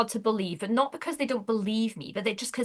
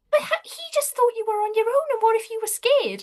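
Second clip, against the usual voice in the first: concerned; 12 semitones higher, 3 dB louder than usual. Retold or imitated. Husband worried for his wife.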